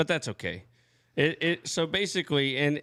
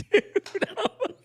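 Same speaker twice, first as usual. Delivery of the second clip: laughing; 13 semitones higher than usual. Retold or imitated. Laughing retelling own reaction.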